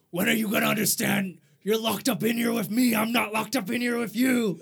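gravelly vigilante growl, voice pushed up; deep growly voice. Retold or imitated. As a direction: rasp it like a brooding masked detective. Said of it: "He has the deep growly voice".

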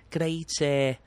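light Scouse impression of a cheerful local; playful imitation of a Liverpool accent. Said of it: "then you go up north to Liverpool, then people are like".

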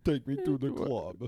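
put-on loud, slow Russian-accented chant; hammed-up Russian club shout; mock Russian accent. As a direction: belt it out like a Russian clubber. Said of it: "trying to do a Russian".

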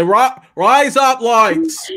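high, drawn-out mock Aussie accent; Australian accent. Shown as a demonstration. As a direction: call out "rise up lights" slowly in put-on Australian. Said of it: "say it in an Australian accent".

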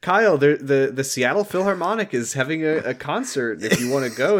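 high, bright eager invite; eager girl voice. Retold or imitated. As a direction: gush it enthusiastically. Voicing Amanda inviting.

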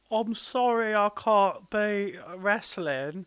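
high, slow put-on whiny voice; Bino impression. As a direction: drag it out in a high pleading voice. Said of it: "my Bino impression".

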